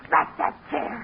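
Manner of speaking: whispered grumble under breath; whispered mutter. Acted. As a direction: mutter it as you bump the chair. Wife stumbles in dark.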